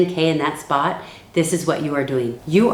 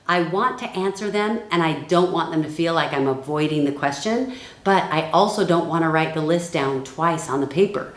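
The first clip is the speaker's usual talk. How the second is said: ordinary talk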